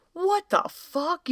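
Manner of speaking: slow, high incredulous what the fuck; baffled slow exclaim. Retold or imitated. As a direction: stretch it out in total bewilderment. Quoting confused listener.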